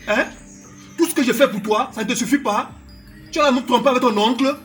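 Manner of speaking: loud, high shouting; furious outburst. Acted. Boyfriend accusing his girlfriend of cheating, furiously. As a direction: explode in outraged disbelief.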